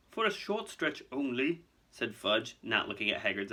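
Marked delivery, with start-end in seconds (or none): 0.1-1.6 higher, slow, placating voice; evasive official. Acted. Fudge answering Hagrid about prison, evasive. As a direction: placate while dodging the question.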